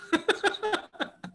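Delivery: a short burst of high laughter. Real, not acted. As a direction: laugh at your own point. Laughs after his own joke.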